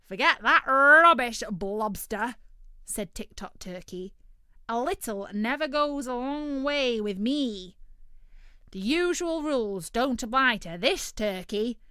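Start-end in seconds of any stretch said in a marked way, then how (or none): 0.0-2.4 loud, high, dismissive bird voice; greedy turkey, waving it off. Acted. Turkey brushes off loudly. 4.7-11.8 loud, high, dismissive bird voice; greedy turkey, waving it off. Acted. Turkey boasts loudly.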